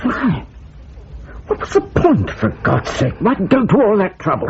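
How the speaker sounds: stunned, voice jumping up in protest; shocked disbelief. Acted. Officer learns he was set up.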